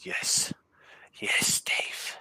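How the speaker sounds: ordinary delivery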